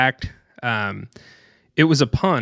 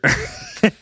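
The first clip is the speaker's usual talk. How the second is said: high, breathless burst of laughter; laughing at the memory, high and airy. Real, not acted. Laughing while telling friend the waiter story, high breathless laugh.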